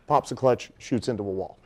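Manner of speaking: usual speaking voice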